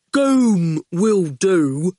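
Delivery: very slow, grinning goon. A narrator's reading. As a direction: drawl it with a daft grin. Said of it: "said the goon".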